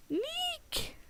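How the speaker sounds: very high-pitched hyena-like squeak; weird hyena noise. Retold or imitated. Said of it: "he makes the weird hyena noise".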